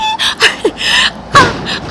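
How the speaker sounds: high mock wailing sob. Mocking the crying fiancee, high fake sob. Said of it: "she starts crying".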